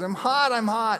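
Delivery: put-on high whiny little boy; mock small child insisting. Scared child voiced again.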